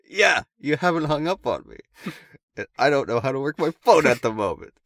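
high, loud comic protest; loud indignant reply. Retold or imitated. High brother answers forgotten-call surprise, loud mock indignation. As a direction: call it down the phone, exaggerated.

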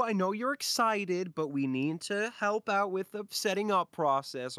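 high, eager dad voice; a father trying to calm his kids; excited dad voice. Acted. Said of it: "the dad's like".

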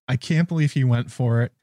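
normal talking voice

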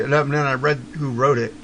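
ordinary delivery

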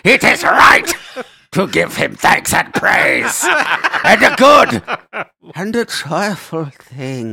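loud, slow mock church response; shouting liturgy. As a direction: belt it like a congregation.

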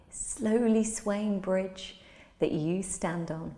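usual speaking voice

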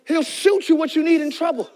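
usual speaking voice

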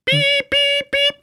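high flat beeping tone; censor bleep. Pretend. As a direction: sing a long beep.

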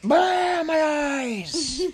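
very high, loud cry; hammed-up high-pitched howl; shrieking lizards. A narrator's reading. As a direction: scream as if dazzled by light. Lizards blinded by bright light.

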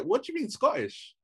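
high-pitched retold disbelief; incredulous question. Acting out own reply to cousin's news, incredulous. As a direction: query it in astonishment, pitch jumping up.

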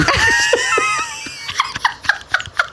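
howling laughter; shrieking laugh. Real, not acted. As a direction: crack up with a shriek. Big laugh at uncle story.

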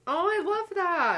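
high, loud delight. Real, not acted. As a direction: gush with delight. Delighted reaction.

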